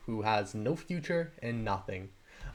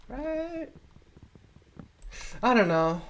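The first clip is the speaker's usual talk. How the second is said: Right? jumps far higher, into a squeak; squeaky high right; 12 semitones higher, slower than usual. Acted. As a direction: pitch the second Right? way up, mock pleading. Repeats the question in a squeak.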